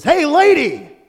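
very high, loud parrot squawk; squawking parrot call. Acted. Parrot calling out to the woman, squawking. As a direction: shriek it like a cheeky parrot.

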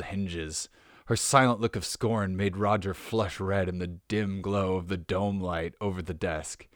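reading novel prose aloud, steady and even; story narration. Acted. Podcaster reading saucy shirt text to listeners, narrating evenly. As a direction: narrate the scene like an audiobook.

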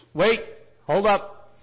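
high, loud halting call; imitated urgent interruption. Listener cutting in when the teacher reaches Joseph, imitated urgent interjection. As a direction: break in as if stopping someone mid-sentence.

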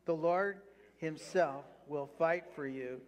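the Lord himself read out slowly for the church to follow; slow, emphatic scripture reading; slow read-along. Shown as a demonstration. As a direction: lead the congregation line by line, unhurried. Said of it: "read it with me".

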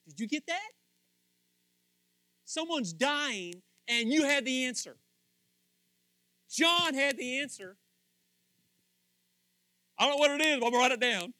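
high, loud rising sermon build; loud preaching climb; preaching at full force. Real, not acted. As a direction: lift the room with every line.

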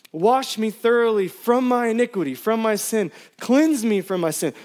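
pleading psalm read with rising intensity; impassioned scripture reading. Acted. Pastor reading a psalm to church, pleading. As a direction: preach the plea as your own. Preacher reads the psalm aloud.

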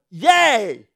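loud, high mock cheer; hammed-up delighted yay; excited yay. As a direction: cheer like your luck just turned. Retold relief, shouting.

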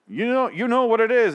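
loud, high mock ghost yell. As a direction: imitate the ghost hollering. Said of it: "the ghost starts yelling at him".

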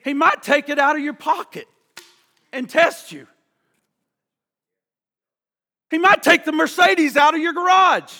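loud, driving sermon voice; preaching at full force. Acted. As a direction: hammer it home from the pulpit like a revival preacher.

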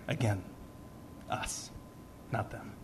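usual speaking voice

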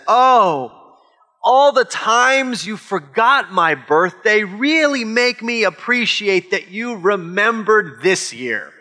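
mock gratitude with a drawn-out guilt trip; passive-aggressive relative. Relative thanking you for a remembered birthday, passive-aggressive. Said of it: "when your relative says".

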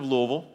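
Louisville swallowed to LOO-uh-vul; Louisville as a local says it, "LOO-vul"; local Kentucky pronunciation. Shown as a demonstration. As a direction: say Louisville the Kentucky way, middle slurred away. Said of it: "That's how we say it in Kentucky".

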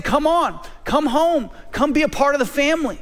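lifted, pleading call to come home; high, eager invitation, a bit rushed at the end; Jesus calling you home. Retold or imitated. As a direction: call out like a shepherd calling the lost one in. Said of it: "and he's saying".

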